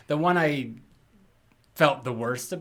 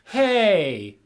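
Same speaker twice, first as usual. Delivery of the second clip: higher, louder, drawn-out movie line; imitated line delivery; 7 semitones higher, 6 dB louder, much slower than usual. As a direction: imitate her delivery slowly and loudly, pitched up. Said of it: "I love her line delivery".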